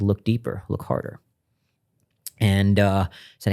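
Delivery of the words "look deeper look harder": whispered, softer divine command; hushed voice from the vision. Retold or imitated. Retelling the voice he heard in the darkness, whispered. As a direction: breathe it out like a voice in the dark.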